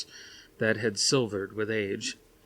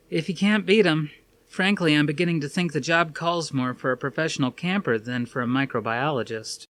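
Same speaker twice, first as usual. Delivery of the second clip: higher, lighter woman's voice; Suzanne's voice; 6 semitones higher, 5 dB louder than usual. A narrator's reading. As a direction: play Suzanne, wry and dry.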